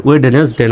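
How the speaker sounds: ওয়েডনেসডে as "wed-nes-de", d sounded; wrong pronunciation. Shown as a demonstration. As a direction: say ওয়েডনেসডে exactly as spelt. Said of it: "ওয়েডনেসডে নয়".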